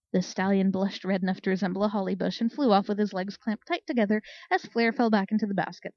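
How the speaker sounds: usual speaking voice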